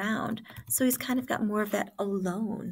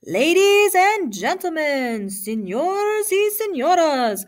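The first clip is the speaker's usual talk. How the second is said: booming, drawn-out, high announcer call; slow ring announcer; 9 semitones higher, 10 dB louder, slower than usual. A narrator's reading. Announcer welcoming the fight crowd in two languages, slow booming call. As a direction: stretch every word out to the whole arena. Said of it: "the announcer spoke slowly".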